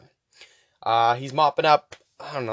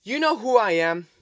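higher, indignant tough-guy rant; pissed off; 4 semitones higher than usual. Retold or imitated. Janitor Walter scolding the robot, mock indignant rant. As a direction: puff up and tell the robot off. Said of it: "he looks pissed".

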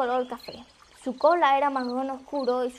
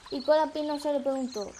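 ordinary delivery

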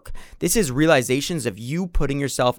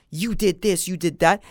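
imitating his old accusing self, higher pitch; a mock accusation aimed at the stressed bookstore clerk; mock angry blame; 5 semitones higher than usual. Retelling how he used to snap at her, mock angry. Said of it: "get angry and be violent and say".

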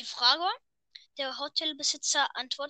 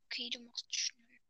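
whispering; 12 dB quieter than usual. Real, not acted. Aside after partner rushes.

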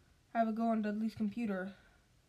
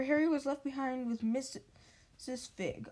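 usual speaking voice

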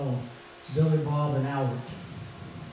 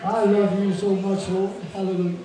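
louder, fervent praise to the Lord; worship praise; 3 semitones higher, 5 dB louder than usual. Real, not acted. Preacher praising the Lord after the hymn, fervent. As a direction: pour out love to God.

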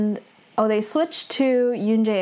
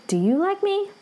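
pitched-up question; 12 semitones higher than usual. Retold or imitated. Hero asks the heroine back, voice jumping up high. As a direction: question jumps up high at the end.

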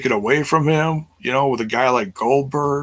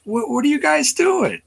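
incredulous. Retold or imitated. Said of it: "It's like".

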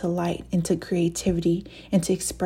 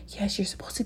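fast, soft whispered reply; whispering; 7 dB quieter, faster than usual. Acted. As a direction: whisper the answer quickly. Answering her own doubt.

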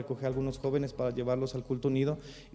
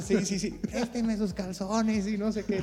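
mocking gang taunt; 7 semitones higher than usual. Gang mocks pastor's costume.

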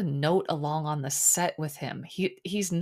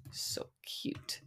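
breathy doting whisper; 13 dB quieter than usual. Pretend. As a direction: whisper it fondly. Whispered coo.

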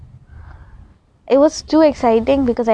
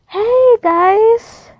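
high, loud bright hello to the viewers; excited greeting; 12 semitones higher, 6 dB louder than usual. Real, not acted. Greeting her viewers, a high loud excited hello. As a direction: burst in with a big cheery hello.

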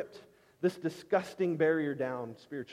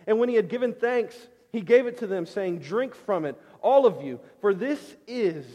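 raised, louder preaching voice; 4 semitones higher, 6 dB louder than usual. Acted. Preacher proclaiming the Last Supper to the congregation, raised and louder.

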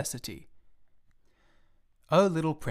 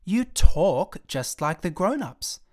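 put-on loud, slow, higher child's accusation; angry accusation; 5 semitones higher, 8 dB louder, slower than usual. Little prince accusing the pilot, loud slow fury. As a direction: spit out each word in outrage. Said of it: "He was really very angry".

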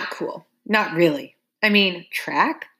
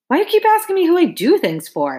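irritated snap, raised pitch; snapped; 11 semitones higher, 4 dB louder than usual. A narrator's reading. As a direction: snap back, fed up. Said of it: "I snapped".

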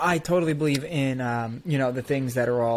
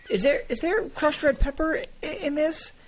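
high-pitched mock puzzled voice; suggestible tasters; 11 semitones higher than usual. People asking about crushed red pepper, high mock puzzlement. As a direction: imitate gullible people querying a taste, pitched way up. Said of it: "now people are all like".

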